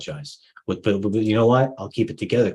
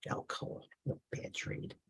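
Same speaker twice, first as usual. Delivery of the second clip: whispered aside; 17 dB quieter than usual. Real, not acted. Muttering to himself over a loss.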